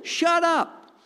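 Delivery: high, loud rebuke, each phrase falling; rebuking shout. Acted. Jesus silencing the devil, loud high rebuke. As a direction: slam each command down. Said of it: "He was saying that to the devil".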